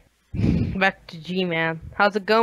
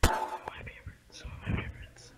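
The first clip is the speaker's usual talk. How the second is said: whisper; 15 dB quieter than usual. Acted. Whispered repeat of the word.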